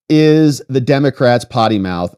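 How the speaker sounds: ordinary talk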